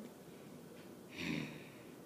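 long, low, breathy sigh; deep frustrated sigh. Real, not acted. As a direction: let out a heavy fed-up sigh. Ran out of bricks.

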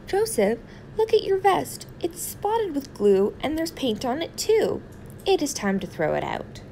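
high-pitched fussy mother voice; a mother fussing over a worn-out blanket; scolding mother. Acted. Mother scolding about vest.

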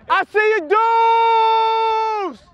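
high, loud shout across the field; excited shout. Real, not acted. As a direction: yell out to the guys, all excitement. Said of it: "Oh, my bad".

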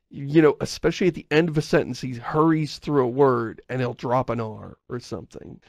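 ordinary talk

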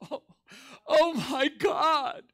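high, loud, drawn-out imitation wail; mock crying outburst. Preacher imitating the man outside the pool hall, crying. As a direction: imitate a man bursting into tears, slow and high. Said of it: "he busted out crying".

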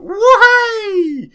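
high, loud whoop; whooping cheer. Real, not acted. Player shoots the enemy, high excited whoop. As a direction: let out a big soaring whoop.

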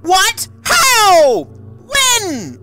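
high, loud, real disbelieving yells; gasping, high screaming disbelief; shocked screams. Real, not acted. Shocked by game's plot twist.

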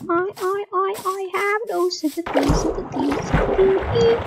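high strained exasperated complaint; exasperated whine. Real, not acted. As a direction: whine it out in frustration. Frustrated after falling.

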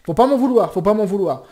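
playful yell at the noisy neighbour; mock shout.

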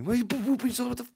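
high-pitched mock angry dad; hammed-up high parental outburst; yelling dad. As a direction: imitate a dad yelling at his kid. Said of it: "My dad's gonna come yell".

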